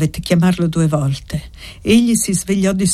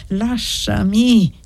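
harsh voice; 3 semitones higher, much slower than usual. A narrator's reading. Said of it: "ella insisté con voce aspra".

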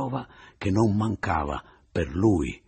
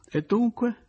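riso acre; 4 dB louder than usual. Acted. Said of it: "ridendo acre".

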